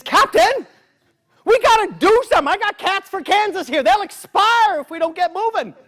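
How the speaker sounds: high urgent impatient plea; impatient urging. Acted. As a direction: push the captain to move now. Impatient Gabe demands.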